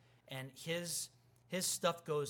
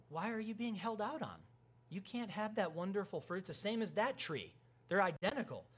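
raised, higher-pitched pleading tone; lightly acted protest in a higher voice; acted questioning; 6 semitones higher than usual. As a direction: put the doubt to them, pitched up.

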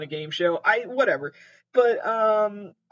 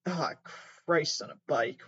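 ordinary talk, nothing marked

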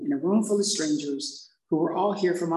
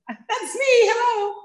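chirpy greeting; 6 dB louder than usual. Retold or imitated. Orderly greeting her cheerfully.

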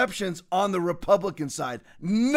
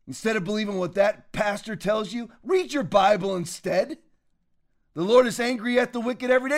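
normal talking voice

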